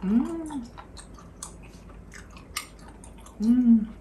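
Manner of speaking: talking while chewing; chewing food. Real, not acted. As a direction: mouth partly full. Seen 3: Closed lips, cheeks moving while she eats a bite at the table.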